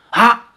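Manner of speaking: a sharp high gasp of alarm; startled cry. Acted. Lin Tiannan startled by the sword at his back, sharp high cry. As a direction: cry out at the sudden thrust.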